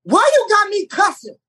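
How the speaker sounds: high squeaky mock old lady, indignant; hammed-up high voice of Pat complaining about the script's cursing; mock Miss Pat. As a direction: squeal it like an outraged older woman fussing about cussing. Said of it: "She said".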